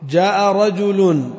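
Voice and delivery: slow "رجل" with its nunation sounded; slow full reading. Shown as a demonstration. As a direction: read slowly and sound the tanween. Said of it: "contrast with pausal take". (قراءة بطيئة مع تنوين "رجل")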